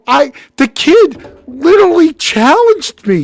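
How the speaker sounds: high, loud, slow justification; indignant protest. Retold or imitated. Player blaming the kid to his girlfriend, indignant protest. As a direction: plead your case with acted outrage.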